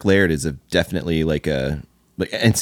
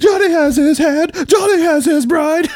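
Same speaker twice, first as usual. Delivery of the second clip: much higher, slow, shouted anguish; Nicolas Cage quote; 4 dB louder, slower than usual. Retold or imitated. Quoting the Moonstruck rant for friends, high howling shout. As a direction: wail it like an unhinged Cage, breaking and loud. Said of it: "quote Nicolas Cage too".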